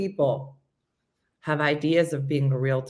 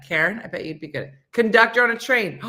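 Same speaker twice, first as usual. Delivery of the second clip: usual speaking voice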